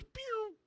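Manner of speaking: high, soft "pew" of a flying ball; ball whizzing sound. Retold or imitated. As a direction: make the ball zip away. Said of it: "And the ball just goes".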